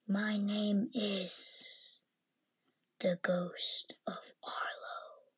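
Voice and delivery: slow spooky whisper; ghost voice. Pretend. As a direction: drag it out like a ghost. Boy playing ghost, slow whisper.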